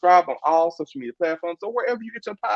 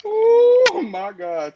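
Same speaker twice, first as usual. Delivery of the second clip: high, slow, louder gasp of words; dramatic surprise; 14 semitones higher, 5 dB louder, much slower than usual. Real, not acted. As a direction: stretch it out in shock. Host reacts to dramatic quote.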